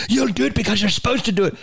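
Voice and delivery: quick, high nun scolding; stern Irish nun. Retold or imitated. Nun overrules a pupil, high mock Irish scolding. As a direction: imitate a half-Irish nun who allows no argument. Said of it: "like, a half Irish accent".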